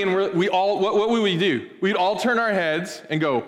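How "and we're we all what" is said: normal talking voice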